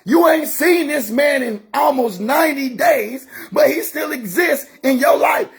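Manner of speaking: rising, shouted preacher's call; fiery preaching. Acted. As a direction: preach it hard at the congregation. Preaching at full force.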